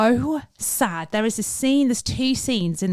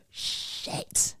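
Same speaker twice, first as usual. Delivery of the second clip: gasp, then breathe the curse unvoiced; sharp gasp then whispered curse; 11 dB quieter than usual. Waking late, she gasps and whispers a curse. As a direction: panic at oversleeping, whisper it. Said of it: "I was like".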